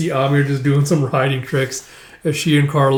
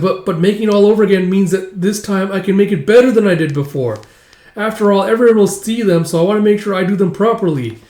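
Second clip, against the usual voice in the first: higher-pitched, eager babble; rambling self-justification, pitched up; flustered yammering; 6 semitones higher, 4 dB louder than usual. Acted. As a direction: yammer on, tripping into second thoughts. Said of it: "yammering a mile a minute".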